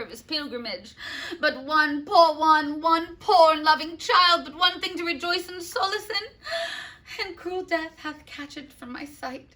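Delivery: loud, high, overwrought lament; lamenting Paris. Acted. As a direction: wail over the lost bride. Paris laments Juliet's death.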